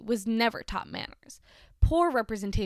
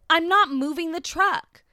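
loud, slow, angry yell; slow furious shout across a boatyard; 8 semitones higher, 7 dB louder than usual. Retold or imitated. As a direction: bark it across the yard at her. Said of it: "the gentleman yelled across the yard".

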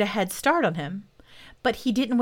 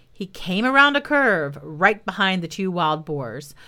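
ordinary delivery

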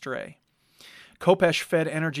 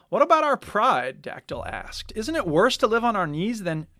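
higher, louder appeal; indignant plea; 6 semitones higher, 5 dB louder than usual. A narrator's reading. Dactyl to Kopesh, indignant about fleeing. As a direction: argue for pride.

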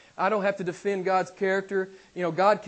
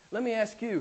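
ordinary delivery

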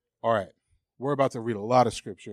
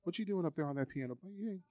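much softer, fast belittling taunt; inner accusing voice; 3 semitones higher, 12 dB quieter, faster than usual. Acted. Preacher voicing the lie told to him, soft and quick. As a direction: mutter the put-down. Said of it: "I'll get real vulnerable".

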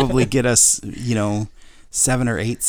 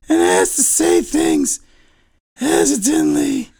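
louder, lower, slow breathy elephant; breathless elephant; 4 semitones lower, 5 dB louder, much slower than usual. Acted. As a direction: gasp for air between words. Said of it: "the elephant kinda talks like this".

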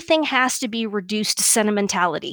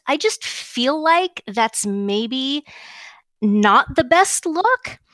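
very slow uptalk, pitch climbing at phrase ends; uptalk affectation; much slower than usual. Shown as a demonstration. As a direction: drag it out tentatively, turning statements into questions. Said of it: "what we were just demonstrating for the benefit of the listeners".

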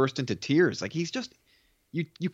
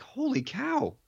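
much higher-pitched amazed exclamation; retold wide-eyed amazement; astonished; 11 semitones higher, slower than usual. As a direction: act out a stunned viewer's reaction, pitched way up. Acting out audience's astonished reaction.

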